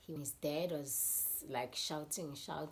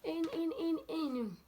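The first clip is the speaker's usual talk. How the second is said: put-on protesting boys; 12 semitones higher, 7 dB louder than usual. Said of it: "And then they're like".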